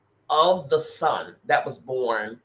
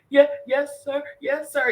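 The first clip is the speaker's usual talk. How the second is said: imitating crying, pitched much higher; tearful, higher yes sir promised to the spirit; retold tearful yes sir; 11 semitones higher than usual. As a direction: replay the sobbing yes sir, voice up high. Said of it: "even through the hard tears".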